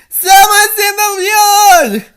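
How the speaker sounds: high, loud panicked yell; alarmed shout. Acted. Dee Dee shouts to Niall about an intruder.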